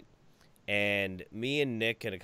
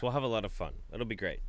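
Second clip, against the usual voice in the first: ordinary talk, nothing marked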